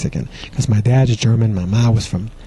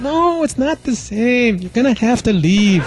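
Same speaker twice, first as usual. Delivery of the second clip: much higher, slow firm brush-off; dismissive girlfriend; 10 semitones higher, slower than usual. Retold or imitated. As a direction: show him the door. Girlfriend dumps him.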